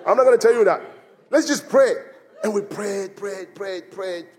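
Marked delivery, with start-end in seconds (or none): 2.4-4.3 fading to a near whisper; quiet desperate praying; hushed prayer. Retold or imitated. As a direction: let the repeated prayed sink away softly. Retelling desperate prayer on boat.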